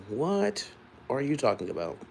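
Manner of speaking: high-pitched, puzzled "what"; baffled. Real, not acted. Answering a comment about an alien, baffled. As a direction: question it in disbelief, starting high.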